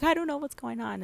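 high, helpless protest; retold bewilderment. Wife telling husband she is baffled, high and worked-up. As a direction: throw it out high, at a loss.

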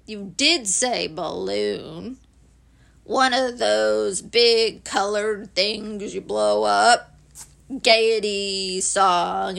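slow, low donkey voice; low, deliberate, doleful marvelling; gloomy Eeyore savouring news. Acted. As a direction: list the delights ponderously.

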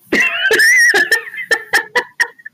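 a very high, loud burst of laughter; real laughter at her own joke; big shrieking laugh. Real, not acted.

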